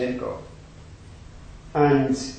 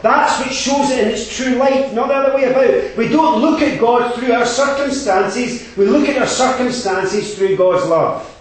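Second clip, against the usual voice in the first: higher, louder fervent exhortation; preaching at full force; 8 semitones higher, 7 dB louder than usual. Acted. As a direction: drive the congregation to take responsibility.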